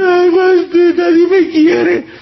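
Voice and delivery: high, slow, whimpering mock lament; whiny self-pity. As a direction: whine like someone who feels unloved. Imitating a complaining lady.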